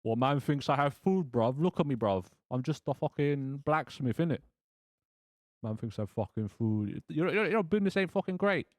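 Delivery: mock British chav blacksmith, mouthy and slangy; half-baked chav accent. Chav blacksmith saying he has no food, mock chav accent. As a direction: put on a clumsy chav street accent. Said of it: "I can't do a Chav accent".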